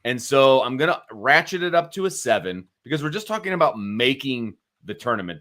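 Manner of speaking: usual speaking voice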